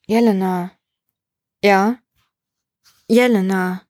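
a little absent-minded, name by itself; slightly absent. A narrator's reading. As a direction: say it as if mind elsewhere. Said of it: "antwortete Sergei leicht abwesend".

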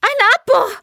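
very high, piercing cry; shrill scream. A narrator's reading. Jelena screams over the radio, shrill. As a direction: shriek it in terror at the top of the range. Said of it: "schrillte die Stimme in der Leitung".

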